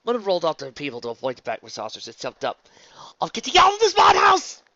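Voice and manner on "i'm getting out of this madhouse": very high, loud cry; panicked shout. Acted. Luna escaping the back massagers, shouting in panic. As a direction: shout it while fleeing.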